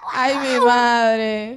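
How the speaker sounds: drawn-out laughing exclamation; laughing, slow. Real, not acted. Cohost reacting to the story, laughing slowly. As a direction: stretch it out while laughing.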